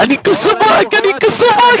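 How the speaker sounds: high squeaky mocking whine; mock whiny crybaby. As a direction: mimic a crying kid to taunt him. Mocking someone crying. (صوت بكاء ساخر حاد وعالٍ)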